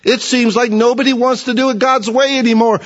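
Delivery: normal talking voice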